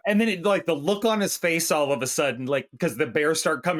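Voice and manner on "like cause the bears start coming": normal talking voice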